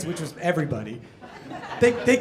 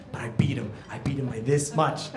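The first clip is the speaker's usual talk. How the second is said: whisper; 7 semitones lower, 7 dB quieter than usual. Real, not acted. Whispered triumph in story.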